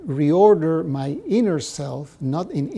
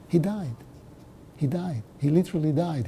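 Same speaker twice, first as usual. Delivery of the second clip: ordinary delivery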